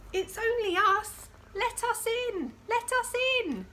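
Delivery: sing-song plea to be let in; voices outside. Acted. Visitors at the door begging Lily to let them in.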